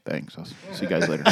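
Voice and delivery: low, soft, laconic sign-off; deadpan impersonation of a man of few words; Mike Dominguez. Acted. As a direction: drop deep and quiet, barely bothering. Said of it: "He'd just be like".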